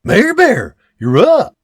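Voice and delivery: high, loud surprised blurt. Acted. Husband loudly surprised to see wife up. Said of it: "William blurts".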